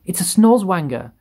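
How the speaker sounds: high, loud alarmed exclamation; police chief's cry. A narrator's reading. Police chief sees a monster, crying out. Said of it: "cried the chief of the police".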